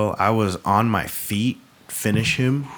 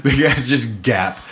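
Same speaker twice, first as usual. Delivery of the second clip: loud, high, laughing through the words; 7 semitones higher, 7 dB louder than usual. Real, not acted. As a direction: crack up while talking. Laughing about the unedited gap.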